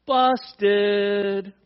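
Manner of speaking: loud, high, drawn-out call; gleeful gotcha. Pretend. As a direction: call it out like a playful taunt. Comic verdict on caught Peter.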